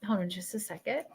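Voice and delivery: very soft, under the breath; soft, private stalling murmur; hushed aside. Real, not acted. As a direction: quietly ask for a moment. Pauses to listen to the vision.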